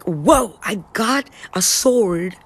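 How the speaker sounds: slow, awed declaration of a hero with a sword; lightly voiced hero, slow and awed; inspired inner voice. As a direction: sound like you've just found your power. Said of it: "And you become like".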